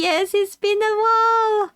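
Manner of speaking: high character voice. Acted. Voices cheerful game character.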